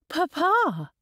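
high, anxious call to a parent; crying out. A narrator's reading. Daughter seeing her shaken father, crying out. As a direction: call out in sudden concern. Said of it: "Elizabeth cried".

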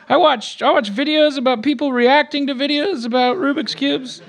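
put-on high, nervous teen boy voice; Morty voice. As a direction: stammer high like a nervous kid. Morty voice held.

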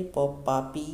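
each syllable called out loud and drawn out; loud syllable drill. Shown as a demonstration. Hindi teacher drilling children through the barakhadi, loud and deliberate. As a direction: chant them crisply for young pupils to copy.

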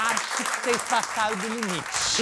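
slow, drawn-out mock reprimand; slowly laid-down verdict in a put-on voice; Adriano Imperador. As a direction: stretch it out like a weary party boss. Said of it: "o Adriano Imperador aparece e fala".

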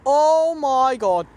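slow, loud, shocked gasp of words; startled. Real, not acted. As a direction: drag it out in shock. Sees a shadow.

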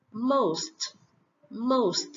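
ordinary talk, nothing marked

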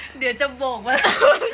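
laughing, drawn-out imitation of her; quoting her, laughing. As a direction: drag it out teasingly while laughing. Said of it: "เธอบอกว่า".

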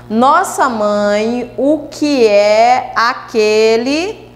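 slow, drawn-out verse reading by syllables; measured, slow scansion. Shown as a demonstration. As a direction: stretch each syllable as if counting out the verse. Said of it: "fazer a separação silábica".

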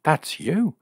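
high-pitched excited discovery. A narrator's reading. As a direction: pipe up high in excited recognition. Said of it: "said Jill excitedly to Jim".

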